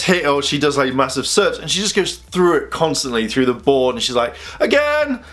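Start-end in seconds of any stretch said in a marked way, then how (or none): 4.6-5.2 high, held exclamation; mock anime cry. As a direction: imitate an anime rival calling out. Anime rival's cry imitated.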